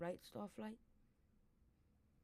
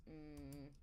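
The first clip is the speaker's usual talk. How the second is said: usual speaking voice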